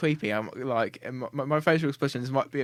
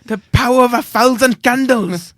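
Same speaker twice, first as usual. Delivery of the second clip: put-on loud, high Welsh accent; Rhod Gilbert; 8 semitones higher, 12 dB louder than usual. Showing off accents, loud Welsh comedian. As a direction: Welsh comic in full flow. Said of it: "I can do a good Welsh accent".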